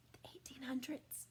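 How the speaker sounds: "The eighteen hundreds" whispered, no voice. Shown as a demonstration. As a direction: hush the date in awe. Whispered repeat for effect.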